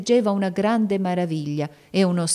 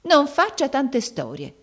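slow, higher, impatient scolding; 9 semitones higher, 3 dB louder, slower than usual. A narrator's reading. Maid cutting off the girl's protests, impatient. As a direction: brush off her fuss, drawn out. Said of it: "rispose l'altra con impazienza".

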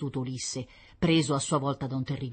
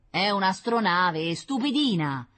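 loud, raised, stretched-out retort; scornful brother; 5 semitones higher, 5 dB louder, slower than usual. Acted. Brother correcting his little sister, scornful. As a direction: contradict her scornfully.

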